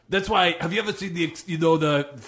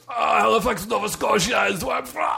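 mock shout; 8 semitones higher than usual. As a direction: belt it out then crack up. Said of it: "He says".

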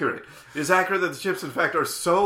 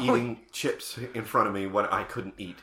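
low, softer resentment; seething anger; 9 semitones lower, 7 dB quieter than usual. Acted. Recalling friend's hospital visit, seething anger. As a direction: keep the grievance low and contained. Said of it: "I have not been this angry".

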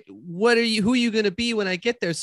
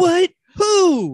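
high, loud shocked outburst; astonished and shrieky; 12 semitones higher, 5 dB louder than usual. Acted. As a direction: blurt it out in disbelief. Reacts to news of real dad.

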